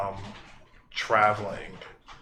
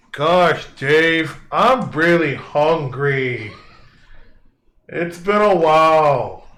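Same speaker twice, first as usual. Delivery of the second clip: loud, booming hungry giant; big dumb giant whining for food, loud; 6 semitones higher, 10 dB louder, slower than usual. Acted. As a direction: bellow it like a starving giant.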